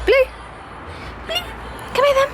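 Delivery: high coaxing call to the dog. Real, not acted. Calling the dog.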